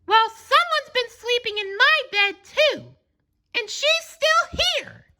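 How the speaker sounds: very high, tiny excited cub voice; a little cub announcing she is still here; Baby Bear exclaiming. A narrator's reading. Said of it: "Then Baby Bear exclaimed".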